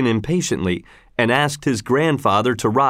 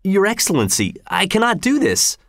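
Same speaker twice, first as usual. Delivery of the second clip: higher-pitched, understated dismayed plea; crying out in protest; 9 semitones higher than usual. A narrator's reading. Father refusing the governor's order, mildly dismayed cry. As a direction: protest with a touch of desperation, pitch up. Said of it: "cried Tell".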